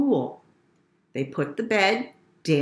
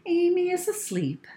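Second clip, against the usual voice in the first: mother; 9 semitones higher than usual. A narrator's reading. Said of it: "said her mother".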